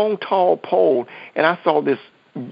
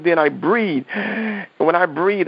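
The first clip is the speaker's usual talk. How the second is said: ordinary talk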